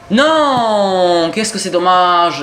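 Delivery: long, loud, high "no" easing into slow regret; real gamer's wail at a missed chance; dismayed cry. Real, not acted. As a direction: cry out in drawn-out disappointment.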